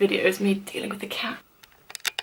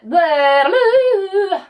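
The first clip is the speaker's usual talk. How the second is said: 1 sung bleh; 9 semitones higher, 7 dB louder than usual. Real, not acted. Frustrated singing out.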